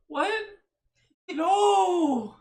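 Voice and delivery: high loud cry of disbelief; shocked shout. Retold or imitated. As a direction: yell it, betrayed. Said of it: "And I was like".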